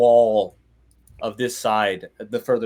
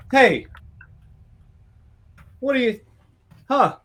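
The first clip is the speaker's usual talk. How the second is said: man in the hole calling up; 7 semitones higher than usual. Acted. Man stuck in a hole calls up to the players, high and startled. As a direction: call up from the hole, high and surprised. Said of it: "he's like".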